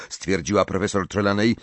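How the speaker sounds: ordinary delivery, nothing marked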